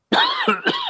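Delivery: harsh chesty cough; smoker's cough. Real, not acted. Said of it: "smoker's cough".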